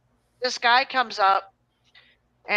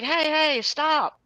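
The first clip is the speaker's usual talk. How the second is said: higher call with a burst of energy; 6 semitones higher, much slower than usual. Retold or imitated. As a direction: shout for him to stop, retold. Burst of energy, yelling stop.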